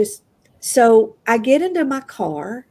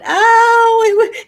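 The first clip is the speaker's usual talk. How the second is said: high, loud peacock-proud "oh"; mock your own swelling self-importance; puffed up; 13 semitones higher, 6 dB louder, slower than usual. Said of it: "getting all puffed up like a peacock going".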